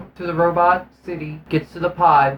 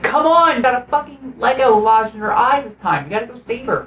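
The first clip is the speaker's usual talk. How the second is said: high pleading; 7 semitones higher than usual. Retold or imitated. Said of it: "and it's like".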